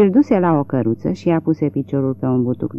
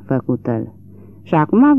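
plain reading voice

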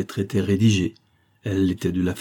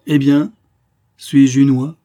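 loud, high triumphant cry; 6 semitones higher, 9 dB louder, slower than usual. A narrator's reading. Niece crows triumphantly to her uncle, loud and high. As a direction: crow in victory over her doubting uncle. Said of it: "lançant à son oncle un regard triomphant".

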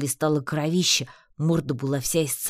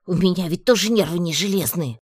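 louder exasperated outburst; loud indignation; 4 semitones higher, 6 dB louder than usual. A narrator's reading. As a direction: defend yourself loudly. Heroine defends her outburst.

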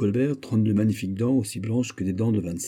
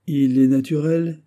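proud ease; 7 semitones higher, 6 dB louder than usual. Acted. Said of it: "avec une orgueilleuse aisance".

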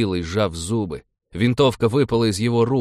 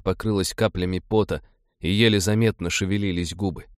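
ordinary delivery, nothing marked

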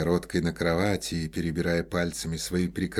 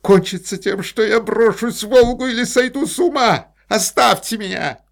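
much higher, loud frantic cry; hysterical outburst; 12 dB louder than usual. A narrator's reading. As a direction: yell in despair.

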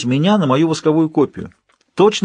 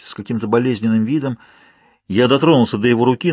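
ordinary delivery

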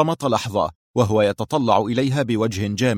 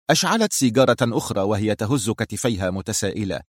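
ordinary delivery, nothing marked

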